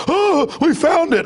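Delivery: sneering impression of a cop gloating over a find; mock cop triumph. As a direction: ham up a dim cop finding the evidence. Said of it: "So they're like".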